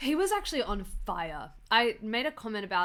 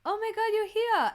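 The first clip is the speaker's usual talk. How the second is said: much higher, thrilled recognition; excited greeting; 12 semitones higher, 5 dB louder than usual. Retold or imitated. Stel recognising her at the photo op, excited. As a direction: gush it like spotting an old friend. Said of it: "and he goes".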